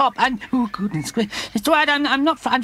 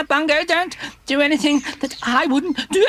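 plain reading voice

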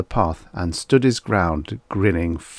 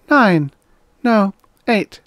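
put-on high little boy's voice; high-pitched schoolboy reply; small boy; 12 semitones higher, 6 dB louder, slower than usual. A narrator's reading. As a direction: answer as a young child, high and loud. Small boy answering the robot.

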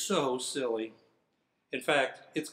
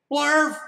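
sudden loud nonsense yell, much higher; silly shout; 11 semitones higher, 10 dB louder than usual. Shown as a demonstration. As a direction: blurt the nonsense word out full force. Forced to read nonsense word.

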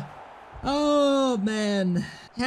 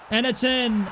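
ordinary talk

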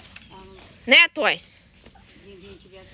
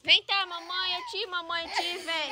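motherly cooing; 3 semitones higher, 13 dB quieter than usual. Real, not acted. Mother comforting her child. (mamãe chamando o neném baixinho)

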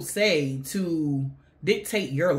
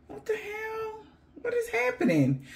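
shocked gasp; 6 dB quieter than usual. Real, not acted. Shocked by the reading.

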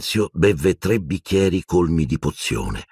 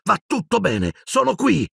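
shaky reassurance. A narrator's reading. Harry soothing Dumbledore.

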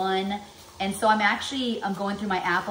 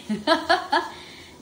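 high, loud real laugh; burst of laughter; 10 semitones higher, 4 dB louder than usual. Real, not acted. Mom laughing at her own brain joke, real laughter. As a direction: let a genuine laugh break out.

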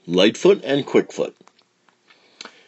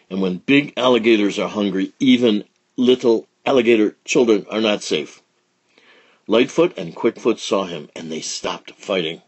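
ordinary delivery, nothing marked

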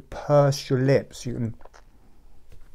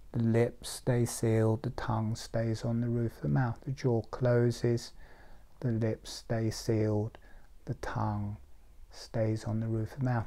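softer, hushed instruction with pauses; guided meditation; 2 semitones lower, 8 dB quieter than usual. Real, not acted. Teacher talking a group through a jaw exercise, meditative. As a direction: guide them gently step by step, letting it settle.

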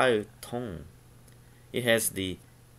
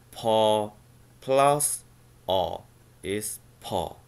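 ordinary delivery

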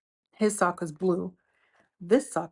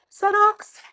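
usual speaking voice

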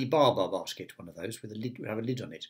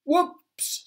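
high, rising startled whoops; surprised whoops; 4 dB louder than usual. Acted. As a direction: yelp it out as the cans tumble. Mister Clumsy knocks over cans.

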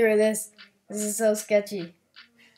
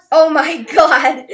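much louder, higher drawn-out cry, breaking into laughter; laughing outburst; 7 semitones higher, 14 dB louder, slower than usual. Real, not acted. Gamer reacting to a silly-looking character, laughing outburst. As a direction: burst out loud, laughing in disbelief.

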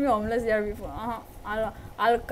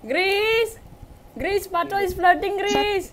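higher, calling out to tease; teasing sing-song call; 7 semitones higher, slower than usual. Acted. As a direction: chant it out to embarrass her friend. Teasing calls at a friend.

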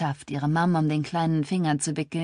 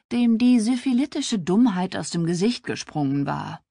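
ordinary delivery, nothing marked

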